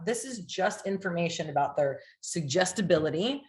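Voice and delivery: ordinary delivery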